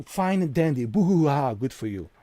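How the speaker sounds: mocking. Mocking viewers' happy moments.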